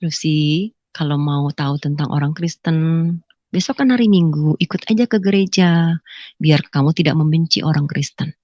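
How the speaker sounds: low, measured retold advice; quoted mother- or father-in-law. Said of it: "Terus mertua saya bilang".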